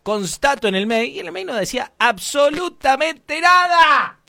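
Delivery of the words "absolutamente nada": "absolutamente nada" high and loud; outraged emphasis. Retold or imitated. Customer found no rule in mail, "absolutamente nada" emphatic. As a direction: punch out "absolutamente nada", pitch soaring.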